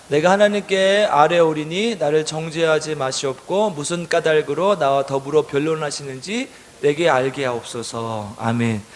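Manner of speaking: slow, measured read-aloud verse; scripture reading. A narrator's reading. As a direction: read the verse slowly for a group to follow. Reads scripture aloud with congregation.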